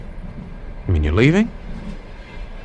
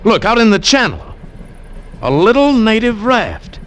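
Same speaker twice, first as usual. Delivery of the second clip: higher and urgent, rising to a cry; excited urging; 9 semitones higher than usual. Acted. Man urging the commandant to chase the raft, excited. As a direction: point it out and rally them to chase.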